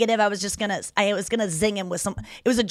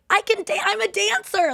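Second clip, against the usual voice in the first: much higher, giddy announcement; triumphant exclamation; 12 semitones higher than usual. Retold or imitated. Just done dancing on TV, triumphant exclamation. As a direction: exclaim it in high-pitched triumph. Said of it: "exclaimed that because it was, like, a triumphant moment".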